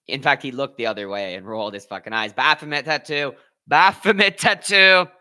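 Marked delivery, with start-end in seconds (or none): 3.7-5.1 slow, high, booming hype; loud excited repeat. Real, not acted. Streamer hypes up the fighter's tattoo, loud and drawn out. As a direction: belt it out, stretching each word.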